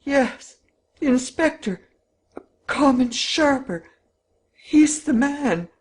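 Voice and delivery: high, loud distraught voice. A narrator's reading. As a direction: cry it out in shock. Robbed husband cries out.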